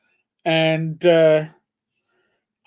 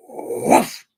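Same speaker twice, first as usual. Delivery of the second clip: unvoiced, breath-heavy woof; imitated cat woof; 3 dB louder than usual. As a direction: huff out one sudden woof. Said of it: "you hear, you hear".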